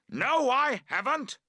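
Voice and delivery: loud high denial; shouting. A narrator's reading. Friend shouting back across the collapsing tent. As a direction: shout him down. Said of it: "he shouts".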